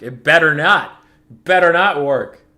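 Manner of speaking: loud, slow, drawn-out warning; mock threat. Streamer daring the opponent's build to fail, mock menace. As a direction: growl a hammed-up threat.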